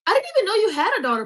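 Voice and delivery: fast, high, loud disbelief; surprised. Real, not acted. Reacting to a viewer's comment in loud surprise. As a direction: blurt it in shock.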